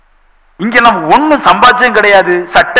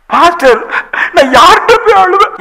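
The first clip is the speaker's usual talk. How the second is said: imitated weeping caller, pitched up; mock tearful plea; 7 semitones higher than usual. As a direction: plead like a caller in tears, acted. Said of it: "நீ எதுக்கு அழுவுற". (அழுவது போல நடிக்கும் கெஞ்சல்)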